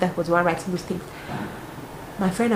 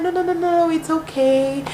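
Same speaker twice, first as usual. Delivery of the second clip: insistent host; 8 semitones higher than usual. Retold or imitated.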